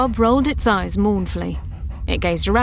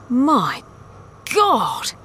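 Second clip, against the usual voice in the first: higher, drawn-out cry of disbelief; shocked exclamation; 5 semitones higher than usual. A narrator's reading. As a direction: stop dead and exclaim, voice jumping up. Franco shocked on seeing the wub.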